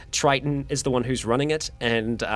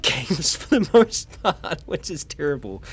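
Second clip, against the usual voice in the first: laughing admission; 3 semitones higher, slower than usual. Real, not acted. Admitting geography learned from Fallout, laughing. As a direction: let real laughter break into the words.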